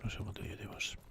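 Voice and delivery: soft whisper to himself. Real, not acted. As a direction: breathe it under your breath. Player repeats it whispered.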